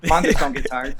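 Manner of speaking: half-shouted tough family rallying cry; tough, punchy motivational shout; get-tough house motto. Acted. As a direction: call it out to toughen someone up. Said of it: "anytime you have to get tough".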